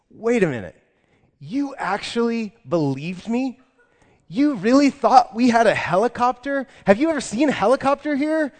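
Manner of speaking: high and loud, scoffing and incredulous; mocking disbelief. Store owner mocking the gullible customer: loud scoffing disbelief. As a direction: rub it in like it's the funniest thing ever. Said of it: "the dude just loses it".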